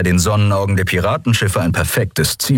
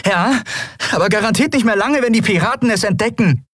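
put-on young women running. Lien and Endriel talk while running.

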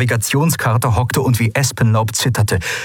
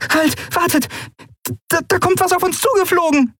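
tiny, very high creature voice in alarm; alarmed small creature. A narrator's reading. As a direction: warn in panic, high and small. Nelen's voice in the story.

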